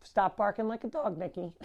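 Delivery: ordinary talk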